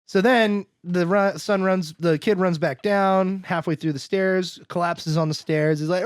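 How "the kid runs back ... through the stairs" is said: ordinary talk, nothing marked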